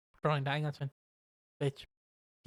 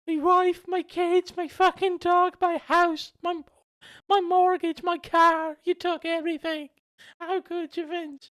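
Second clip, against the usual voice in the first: very high, hammed-up anguished lament; Tommy Dreamer; 14 semitones higher, 4 dB louder than usual. Wrestler blaming Vince for losing everything, mock wailing. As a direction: wail out the list of losses in a silly high voice. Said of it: "And then Dreamer's like".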